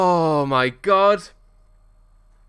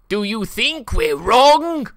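slow, higher Simon voice; Simon impression; 5 semitones higher, 4 dB louder, much slower than usual. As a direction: drag it out as Simon. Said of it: "Good Simon impression".

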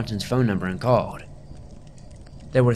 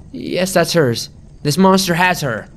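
higher, louder anguished accusation; cried out; 7 semitones higher, 6 dB louder, slower than usual. Acted. Mother accusing the narrator, anguished louder cry. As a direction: cry it out at him. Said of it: "she cried".

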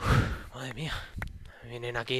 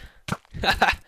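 high triumphant laugh; gloating laugh; 7 semitones higher, 7 dB louder than usual. Real, not acted. After kill.

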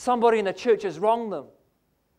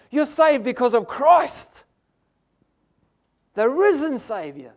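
preaching at full force; 6 semitones higher than usual. Acted. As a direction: preach it out to the congregation. Preacher builds to a climax.

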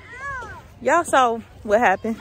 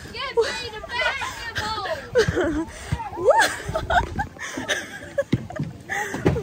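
high rolling laugh; delighted laughter; 12 semitones higher, 5 dB quieter than usual. Real, not acted. Laughing after slide.

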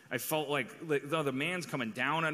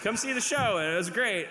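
theatrical testimonial; 7 semitones higher, 3 dB louder than usual. Retold or imitated. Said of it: "theatrical testimonials".

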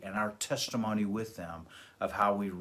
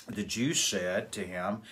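usual speaking voice

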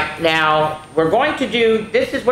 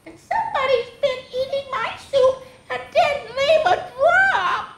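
put-on very high, squeaky tearful baby. Baby finding the soup all gone, baby wail. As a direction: wail it like a tiny child. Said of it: "said the baby".

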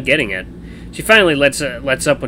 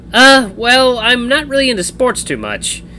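sheepish excuse; 12 semitones higher, 6 dB louder, slower than usual. A narrator's reading. Caught out, admits ignorance of sports.

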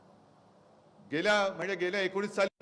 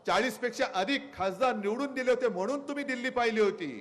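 ordinary talk, nothing marked

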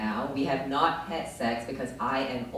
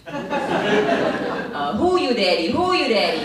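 much higher, loud broken-English question; 6 semitones higher, 9 dB louder, much slower than usual. Retold or imitated. She tries her English on the French guy, loud and high. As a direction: fire "who you daddy" at him, hammed up. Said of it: "I said".

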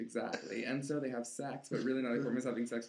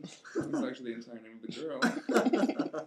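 normal talking voice

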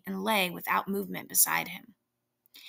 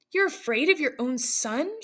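higher, accusing while struggling; indignant cry; 6 semitones higher than usual. A narrator's reading. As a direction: throw it at him in disbelief. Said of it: "she cried, struggling".

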